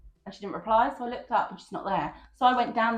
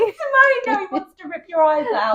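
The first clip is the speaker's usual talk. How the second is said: mock alarm; 12 semitones higher, 12 dB louder than usual. Real, not acted. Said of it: "teasing reply".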